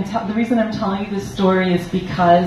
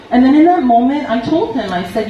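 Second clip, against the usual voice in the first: ordinary talk, nothing marked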